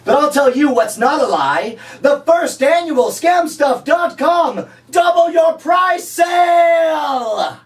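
high, slow, shouted sale announcement; announcer hype. Pretend. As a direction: boom it out like a game show host. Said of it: "I'm like".

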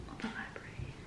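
hushed whisper in the library; barely voiced, keeping it down. Real, not acted. Whispering so as not to disturb the library. Comparing noisy study spots.

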